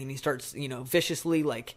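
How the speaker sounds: normal talking voice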